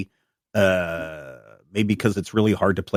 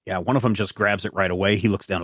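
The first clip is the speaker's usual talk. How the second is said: ordinary talk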